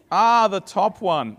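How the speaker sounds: drawn-out high "ah" of discovery; slow, loud dawning realization; realizing aloud. Real, not acted. Speaker finding the right clicker button, slow surprised realization.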